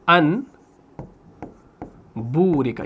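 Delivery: انبورکا split with a long gap, "an... boorika"; very slow, low and separated انبورکا; slow dictation. Shown as a demonstration. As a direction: say it piece by piece as you write. Spelling out example while writing.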